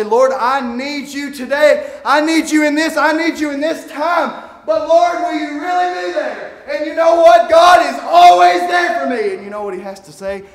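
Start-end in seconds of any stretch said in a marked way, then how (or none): 0.0-6.6 a heartfelt plea in prayer; pleading prayer. Retold or imitated. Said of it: "I'll say". 6.7-9.3 high, loud, fervent preacher's cry; fiery pulpit shout, slowing on the last line; preaching at full force. Acted. Preaching peak.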